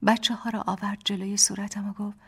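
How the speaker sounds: ordinary delivery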